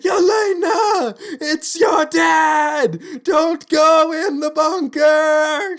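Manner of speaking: very high, drawn-out hammed-up yell; imitated dad shouting. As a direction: holler like an overexcited dad calling from afar, stretching every word. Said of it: "He gets out of the car, he's like".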